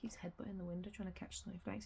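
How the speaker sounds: hushed whisper while watching. Real, not acted. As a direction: whisper so the cat stays calm. Laughing at the cat by the window.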